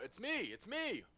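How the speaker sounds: high-pitched call after someone. Acted. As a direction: call out to be recognised. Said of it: "I call out".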